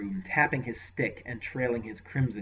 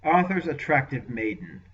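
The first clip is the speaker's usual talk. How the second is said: contained venom; 4 semitones higher, 7 dB louder than usual. Acted. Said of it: "described her with contained venom".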